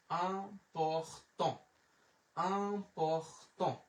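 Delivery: important stretched very slowly, syllables apart; slow teacher's demo of important, piece by piece. Shown as a demonstration. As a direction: break important into drawn-out sounds. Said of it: "এটা হচ্ছে important".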